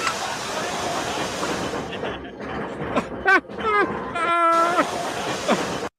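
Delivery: breathless high wheeze of laughter; long soundless wheeze; silent wheezing laugh. Real, not acted. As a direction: laugh so hard no voice comes. Watching old video of himself.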